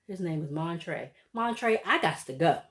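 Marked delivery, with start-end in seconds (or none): 1.3-2.6 louder, mildly exasperated; paraphrasing her walking out, lightly acted; retold exit line. Wife to producer, a touch exasperated. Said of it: "I'm not quoting her verbatim".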